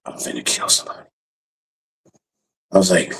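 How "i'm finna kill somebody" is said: fast whispered threat; intense threat. Retold or imitated. Father hearing son news, fast and whispered. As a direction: hiss it out quick under breath.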